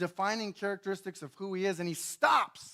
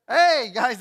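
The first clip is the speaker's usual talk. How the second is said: mock call-out; 4 semitones higher, 13 dB louder than usual. Jonah calling to sailors.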